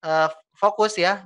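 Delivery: ordinary talk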